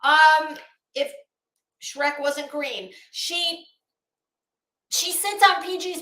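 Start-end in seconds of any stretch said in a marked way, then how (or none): none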